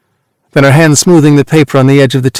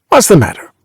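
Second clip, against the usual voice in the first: brusque; 6 semitones higher than usual. A narrator's reading. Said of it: "he asks brusquely with a frightened look".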